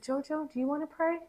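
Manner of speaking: hushed, tender invitation to pray; gentle bedtime question. Retold or imitated. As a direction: ask a drowsy child gently. Softly asking her sleepy child.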